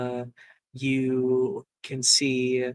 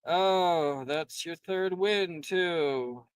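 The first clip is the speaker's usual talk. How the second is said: unimpressed; 7 dB quieter, much slower than usual. Acted. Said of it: "the unimpressed Carney say".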